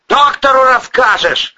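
high, loud dismissive shout; angry bark. A narrator's reading. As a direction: bark down the excuse. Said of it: "рявкнул Порешайло".

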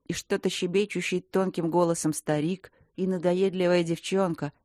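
usual speaking voice

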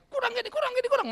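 high-pitched retold fit of nagging anger; angry outburst. Preacher replaying his temper at field shortfalls, mock angry outburst. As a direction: imitate yourself losing your temper, pitched right up. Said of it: "Marah kan".

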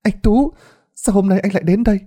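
high, startled question; surprised young woman. Acted. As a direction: caught off guard seeing him. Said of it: "Cô lên tiếng".

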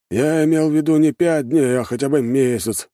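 put-on high, displeased old shopkeeper; Пахом frowning. A narrator's reading. Shopkeeper objects to the short deadline, displeased. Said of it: "нахмурился Пахом".

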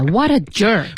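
slow, drawn-out what a jerk; insult with feeling. Shown as a demonstration. Co-host drilling the insult with learners, said with feeling. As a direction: stretch out the put-down. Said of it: "一定要说出那种感觉".

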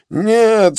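high, loud anguished no; anguished cry. A narrator's reading. Joseph cries out against the charge, anguished. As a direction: cry out in horror. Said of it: "вскричал он".

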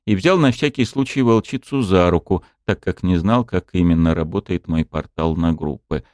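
usual speaking voice

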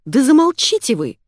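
loud, high, exasperated outburst; angry. A narrator's reading. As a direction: tell everyone off to silence them. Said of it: "разозлилась Оксана".

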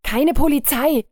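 hoch und laut, voller Panik; panischer Ruf. A narrator's reading. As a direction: verzweifelt abwehren. Panischer Mann wehrt Polizei ab. (panischer, lauter Ausruf)

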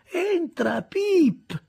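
high, loud call through the door. Acted. Miss Havisham calls Pip into her room, high and loud. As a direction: summon him in from afar. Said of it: "mormorò ancora".

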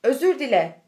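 loud sharp command to apologize; snapped order. A narrator's reading. Rich father ordering his son, loud and sharp. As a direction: bark it at the boy. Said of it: "diye çıkıştı oğluna".

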